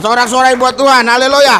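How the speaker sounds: loud, rousing call to the crowd; loud preacher's rallying call. Real, not acted. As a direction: lift the room with a loud cry. Calling the crowd to cheer.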